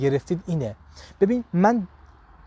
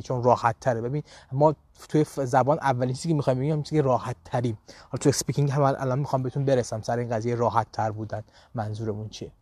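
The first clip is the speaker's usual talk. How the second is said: ordinary talk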